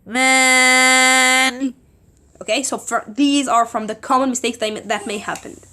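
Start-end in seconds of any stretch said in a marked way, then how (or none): 0.0-1.7 nasal. Shown as a demonstration. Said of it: "Instead of".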